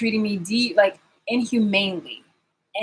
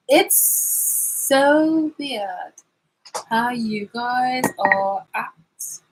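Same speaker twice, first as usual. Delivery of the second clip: drawn out; 4 semitones higher, much slower than usual. Real, not acted. Drawn-out mocking.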